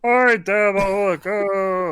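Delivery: put-on whiny sitcom husband impression; Ray Romano. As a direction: groan at your wife in a put-on voice. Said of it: "Ray Romano's trying to sleep".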